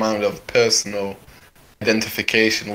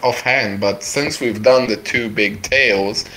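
ordinary talk, nothing marked

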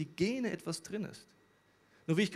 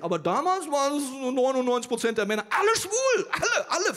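loud squeal climbing into falsetto; mock hysterical sarcasm; 9 semitones higher, 8 dB louder than usual. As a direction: escalate absurdly, shrieking the last words. Sarcastic exaggeration of the claim.

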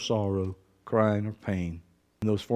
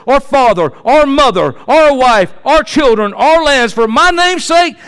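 shouted scripture, climbing higher and louder; full-force preaching; 12 semitones higher, 12 dB louder than usual. Real, not acted. Preacher thundering scripture at the congregation, full-force preaching.

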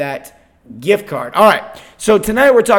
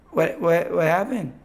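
dazed, bewildered; 3 dB quieter, slower than usual. Retold or imitated. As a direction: re-enact coming round after passing out. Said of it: "I, I passed out".

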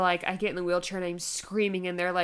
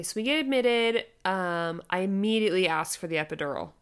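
usual speaking voice

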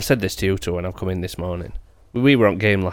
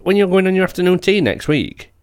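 mimicking wife; 7 semitones higher, 3 dB louder than usual.

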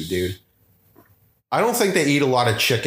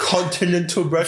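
mock posh; 4 semitones higher than usual. Mocking a skit line.

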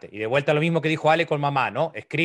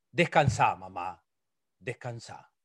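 much softer tender goodbye; tender farewell; 7 semitones lower, 6 dB quieter, slower than usual. Acted. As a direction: let mother rest, barely above a breath. Despedida tierna a mamá. (despedida tierna, muy suave)

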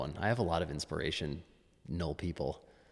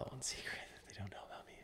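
fast whispered aside; whispering; 17 dB quieter, faster than usual. Real, not acted. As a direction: whisper it like a secret. Mock secretive aside.

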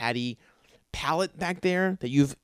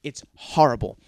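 usual speaking voice

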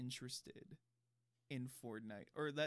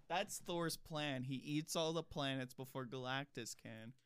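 ordinary delivery

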